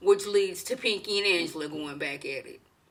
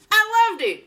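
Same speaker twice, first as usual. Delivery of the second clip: much higher and louder, thrilled; delighted squeal; 12 semitones higher, 7 dB louder than usual. Real, not acted. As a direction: gush with delight. Delighted about old school drama.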